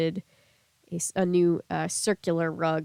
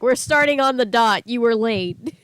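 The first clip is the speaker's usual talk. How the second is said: bossy scolding voice, louder; mock strict host; 4 semitones higher, 8 dB louder than usual. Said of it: "it's kind of unfair to be like".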